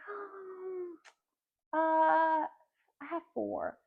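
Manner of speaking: high, drawn-out hesitation, then slow answer; slow, high child's pondering; imitated thinking child. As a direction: imitate a little girl working out her answer. Imitates small daughter pausing to count.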